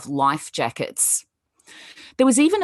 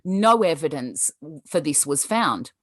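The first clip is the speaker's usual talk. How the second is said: ordinary delivery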